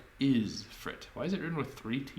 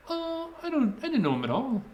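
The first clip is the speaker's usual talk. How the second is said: put-on [name] accent; 13 semitones higher, 5 dB louder than usual. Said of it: "It started sounding like [name] there".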